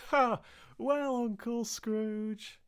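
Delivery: very high, drawn-out toast; high, slow cheerful shout; merry cry. Acted. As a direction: call out the toast in glee. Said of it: "they cried".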